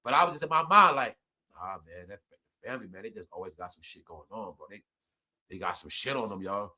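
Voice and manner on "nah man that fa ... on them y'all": much lower and much softer, muttered to himself; inner thought. Retold or imitated. Privately thinking his family always has trouble, low and hushed. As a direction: say it under your breath.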